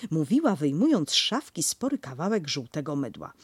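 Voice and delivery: usual speaking voice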